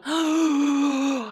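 a sudden gasp of remembering; surprised intake of breath; sharp gasp. Real, not acted. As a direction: gasp as it hits you.

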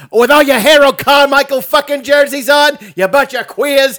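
high, loud jeering tirade; mock the town's fans; imitated rant. Comic insults Philly fans, high loud imitated rant. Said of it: "His rant at the city of Philly".

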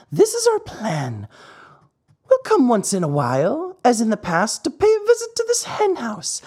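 high, sly weasel voice; wheedling weasel making a deal, pitched high; high, scheming weasel. Acted. Weasel proposes secret deal.

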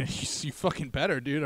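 usual speaking voice

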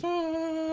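high, soft crowd "ah"; crowd cheer. Acted. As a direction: mimic a distant cheering crowd. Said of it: "And the crowd goes".

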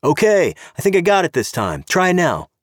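high, called across to the driver; calling out. Acted. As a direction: sing out over the engine noise. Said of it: "he called".

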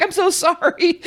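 high, meek, sorry little voice; apologetic chatbot. Retold or imitated. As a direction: grovel like a servile bot. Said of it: "and they'll just be like".

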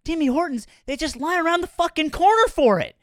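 high, loud exasperated imitation; imitating an indignant coffee loyalist; mock-serious Canadian. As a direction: rant it way up high, hammed-up bafflement. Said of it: "gets real serious".

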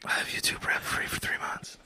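hushed unvoiced whisper. Real, not acted. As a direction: say it in a secret whisper. Boasting in a joking whisper.

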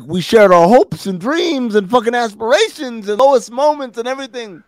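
hammed-up high voice, pleading; [name]'s weird voice.

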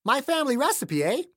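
put-on high-pitched woman with a strange regional accent; high, odd northern accent; Clayton-like accent. A narrator's reading. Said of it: "in an accent just like Clayton's".

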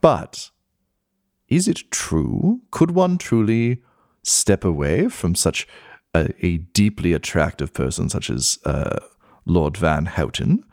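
mannered society gossip with swooping pitch; skull gossip columnist, intrigued. Acted. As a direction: relish it like an old society columnist.

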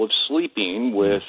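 normal talking voice

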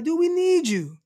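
high-pitched mock plea; pleading outburst, pitched well up; exasperated plea. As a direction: plead with a teammate, voice jumping up. Acts out pleading with absent player.

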